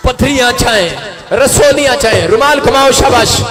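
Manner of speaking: loud, punched declarations falling at each end; full-force healing preaching. Real, not acted. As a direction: proclaim freedom to the crowd, line after line. (पूरे ज़ोर से चंगाई की घोषणा)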